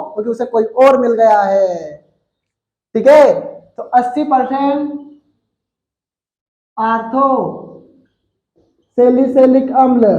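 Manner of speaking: ordinary talk, nothing marked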